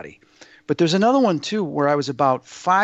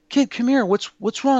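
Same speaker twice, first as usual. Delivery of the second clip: put-on higher-pitched call to a child; imitated concerned neighbor; 9 semitones higher than usual. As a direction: lightly act out a man calling a kid over. Concerned neighbor calls lost child.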